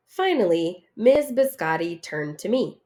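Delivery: plain reading voice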